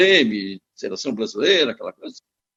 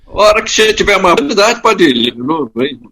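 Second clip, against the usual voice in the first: louder, warm farewell on a choppy call; loud goodbye over a bad line; 8 dB louder than usual. Real, not acted. Guest thanking the host as the line breaks up, loud. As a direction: make sure the thanks carry through the noise.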